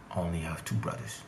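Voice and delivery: ordinary talk, nothing marked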